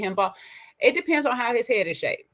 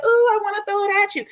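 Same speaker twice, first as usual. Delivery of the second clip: much higher, louder mock swoon; mock swooning woman; 12 semitones higher, 4 dB louder than usual. Mocking women charmed by his talk, hammed-up swoon. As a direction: ham up a smitten woman. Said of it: "they just be like".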